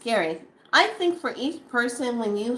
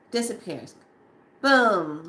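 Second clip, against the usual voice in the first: ordinary talk, nothing marked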